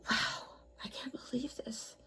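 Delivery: breathless little whisper of disbelief; awed whisper. Real, not acted. Alone with her hoard, she whispers in amazement. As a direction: whisper it to yourself, stunned.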